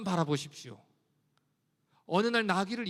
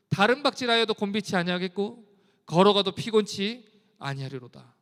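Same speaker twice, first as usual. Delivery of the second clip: measured, lower-pitched proclamation of scripture; scripture reading; 4 semitones lower than usual. Acted. Preacher reads Isaiah's promise to the weary congregation. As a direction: read it as a solemn, rising proclamation.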